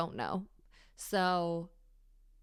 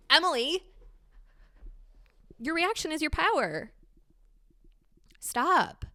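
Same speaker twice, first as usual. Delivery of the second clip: stern mock scolding, higher and louder; self-scolding; 9 semitones higher, 6 dB louder than usual. Scolding herself by name, stern mock reprimand. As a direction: order yourself to stop. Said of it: "almost shaming myself".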